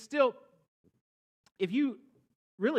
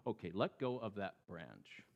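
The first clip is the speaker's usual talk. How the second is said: low, soft, matter-of-fact command; God calmly telling the man to let go; calm God instruction; 7 semitones lower, 9 dB quieter than usual. A narrator's reading. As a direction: tell him gently to let go. God's instruction.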